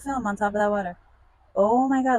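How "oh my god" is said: loud, high cry of disgust; shocked outburst. Real, not acted. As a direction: blurt it out, appalled. Sees how yellow water is.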